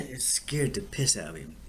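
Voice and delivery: ordinary talk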